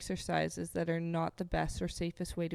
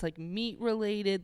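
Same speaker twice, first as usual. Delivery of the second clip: normal talking voice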